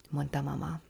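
usual speaking voice

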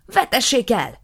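loud, high shouted order; furious demand. Acted. Nisim demanding from his brother, loud and high. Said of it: "követelte Nisim".

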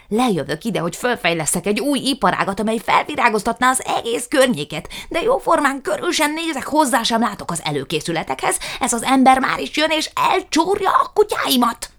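a pompous man working himself into a rage; loud, climbing tirade; outraged rant. A narrator's reading. Jukrics ranting at the lawyer.

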